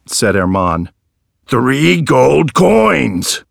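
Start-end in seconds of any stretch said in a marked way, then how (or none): 1.5-3.4 very slow, pressing each word; aggressive repeat. A narrator's reading. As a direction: hammer out three gold coins. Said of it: "repeated the man aggressively".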